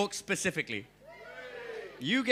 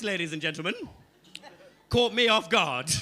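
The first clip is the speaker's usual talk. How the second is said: usual speaking voice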